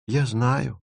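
faint, high, ailing little voice; sickly voice. A narrator's reading. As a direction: say it softly and high, as if unwell. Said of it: "сказала Маша болезненным голосом".

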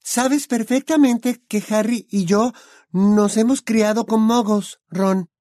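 put-on high, bright young witch voice; Hermione's voice. A narrator's reading. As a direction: voice the clever girl friend.

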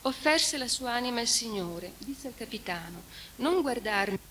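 a mournful recitation of the Trento lament; solemn reading of a lament. Acted. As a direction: read it like a mourning poem. Reads the lament translation aloud.

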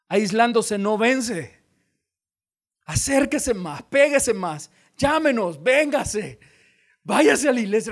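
más fuerte y alto, exhortando; predicación ferviente. Acted. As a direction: ruega a la iglesia que se acerque. Exhortación final. (el pastor llama a no aislarse, con fervor)

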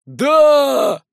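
high, loud roar of assent; drawn-out rallying yell; crowd's shout. A narrator's reading. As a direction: roar yes with the whole crowd. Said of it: "подхватила и вся остальная толпа".

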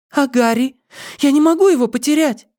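put-on very high girlish protest; high-pitched anxious daughter; pleading girl. A narrator's reading. Girl fears losing Harry.

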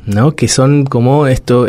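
ordinary talk, nothing marked

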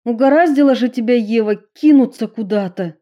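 high, loud, fast scolding; angry scolding. A narrator's reading. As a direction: scold her angrily. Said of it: "разозлилась Эмили".

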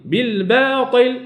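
slow بالباطل with a long thin aa; light baa and alif. Shown as a demonstration. Teacher repeating the model word, slow light baa. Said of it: "الباء مرققة".

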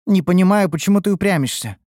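annoyed at being opposed; frowning. A narrator's reading. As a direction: object with a frown. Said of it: "нахмурилась Ульданте".

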